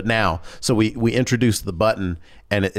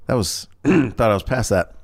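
ordinary talk, nothing marked

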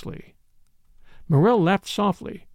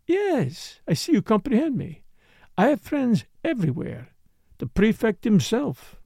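put-on higher, accented dancer voice; knowing foreign dancer; 5 semitones higher than usual. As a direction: play the accented dancer, confiding and emphatic.

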